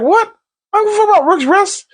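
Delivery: squeaky high, fast brat voice; whiny high Aegon. Retold or imitated. Vlogger mocking Aegon brushing off Aemon's plan, squeaky high whine. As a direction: Aegon squeals his outrage, high and quick. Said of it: "Aegon's like".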